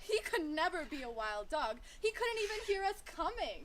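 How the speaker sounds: loud, high, incredulous jeering; mocking. A narrator's reading. Animals ridicule the little sheepdog, mocking. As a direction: poke fun with exaggerated swoops of pitch. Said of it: "they started laughing at him".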